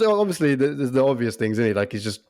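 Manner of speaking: ordinary delivery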